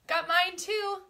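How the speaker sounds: jittery coffee high; wired on coffee, jumping between loud and hushed; too much caffeine. Real, not acted. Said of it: "this is me on coffee".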